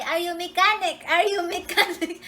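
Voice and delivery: high, loud calling out; retold shout. Shouting to a guy on a motorcycle, retold shout. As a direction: re-enact hailing a passer-by urgently. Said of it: "I shouted to the guy".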